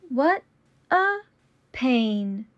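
slow, each word apart. Shown as a demonstration. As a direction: say it slowly, word by word. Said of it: "repetition drill, slowed take".

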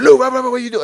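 loud, high mock scolding from an angry coach; mad coach. Thai coach yelling at his fighter, loud and high. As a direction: bark it like a furious coach. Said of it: "coach kinda got mad at me".

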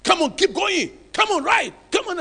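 mock coach yelling at players; screaming coach. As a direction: bark it like a coach on the touchline. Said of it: "a coach who screams at them".

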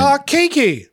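light up at the name; excited greeting. Real, not acted.

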